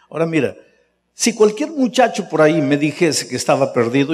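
usual speaking voice

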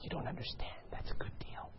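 hushed whispered plea; pleading whisper. Retold or imitated. Shopper whispering excuses to God about a bargain, hushed. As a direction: whisper your excuse as if caught in the aisle.

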